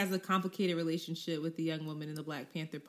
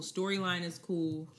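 usual speaking voice

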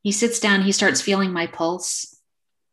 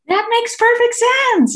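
very high, loud, slow mock-delighted cry; overjoyed; 6 dB louder, slower than usual. Acupuncturist clapping at the patient's diagnosis, overjoyed. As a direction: imitate someone thrilled, stretching the words out in glee. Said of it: "Why are you so happy?".

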